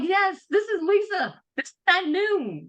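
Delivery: high-pitched retold comeback; animated self-quote, high and emphatic; mock matter-of-fact insistence. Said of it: "I'm like".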